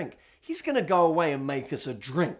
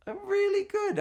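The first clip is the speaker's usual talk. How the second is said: mock chirpy reply; 3 dB quieter than usual. Answering Dalton, energy lifting.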